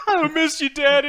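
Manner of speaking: high, loud whiny begging; whiny Sami. Retold or imitated. Mocking Sami whining to Kurt to come back. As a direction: beg like a needy kid to daddy.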